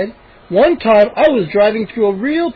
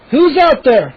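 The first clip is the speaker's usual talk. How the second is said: calling out; 7 semitones higher, 5 dB louder, slower than usual. A narrator's reading. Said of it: "my father called".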